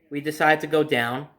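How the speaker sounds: usual speaking voice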